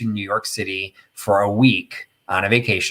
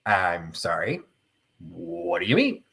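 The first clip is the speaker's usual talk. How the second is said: taken aback; 4 semitones lower, 7 dB quieter, slower than usual. Retold or imitated. Taken aback by student's question, acted.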